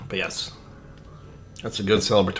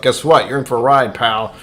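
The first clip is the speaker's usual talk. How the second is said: mock warning; 4 semitones higher, 7 dB louder than usual. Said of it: "me telling the rest of myself".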